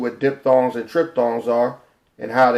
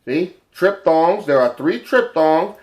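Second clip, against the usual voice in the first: ordinary delivery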